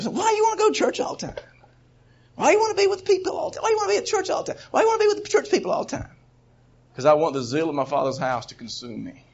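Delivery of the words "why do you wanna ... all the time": much higher, fast, whiny mocking voice; mock the naysayers in a squeaky rush; nagging critics. Preacher imitates people nagging about church, high and whiny. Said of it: "people keep on saying".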